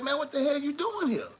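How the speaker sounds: high, startled "what the hell"; mock astonished lawyer; surprised lawyer. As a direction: lawyer taken aback seeing his client beaten. Lawyer surprised to see him.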